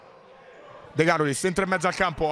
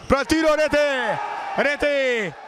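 breathless italian goal commentary; 6 semitones higher, slower than usual. Real, not acted. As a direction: explode on the goal call. Goal scored, commentator erupts.

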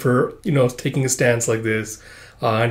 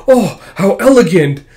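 awed gentleman; 6 semitones higher, 7 dB louder than usual. Acted. Boy admires ballet pose.

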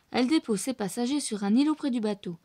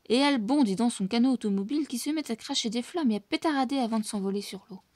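ordinary delivery, nothing marked

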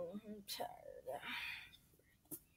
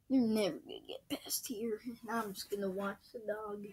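whispering; 11 dB quieter than usual. Acted. Hushed taunt.

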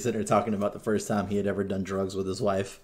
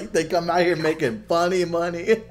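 laughing repeat of the brag; 9 semitones higher, 5 dB louder than usual. Retold or imitated. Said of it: "he's just like".

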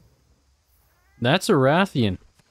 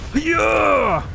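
triumphant shout; 12 semitones higher than usual. Real, not acted. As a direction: cheer it out. Triumphant cry mid-fight.

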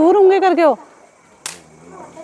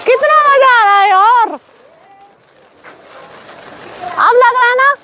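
higher and thrilled; excited; 10 semitones higher than usual. Real, not acted. Vlogger thrilled by the fireworks, excited. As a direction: gush about the fun.

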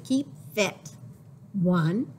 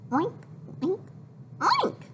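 high squeaky oinks rising to a squeal; pig oinks; 8 semitones higher than usual. Acted. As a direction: oink like a cartoon piglet. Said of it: "I say".

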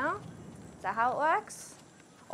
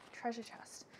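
hushed aside, much softer; whisper; 3 semitones lower, 18 dB quieter than usual. Real, not acted. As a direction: breathe it like a secret find. Spots a chest mid-sentence.

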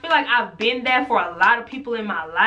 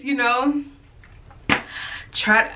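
ordinary talk, nothing marked

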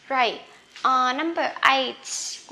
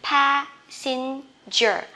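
passenger stretched out very slowly; slow model; 2 semitones higher, much slower than usual. Shown as a demonstration. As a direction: drag out passenger syllable by syllable. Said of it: "không phải là".

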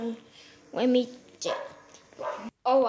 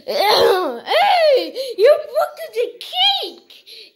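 high, louder outraged accusation, half laughing; high scolding; 6 semitones higher, 4 dB louder, much slower than usual. Acted. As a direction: accuse them, laughing through it. Character scolds the broken cake.

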